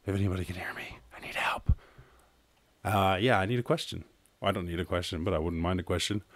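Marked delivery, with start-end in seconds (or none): 0.1-1.7 mock desperate whisper for help; joking hushed plea for help. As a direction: whisper it like a stranded castaway. Mock distress call in outro.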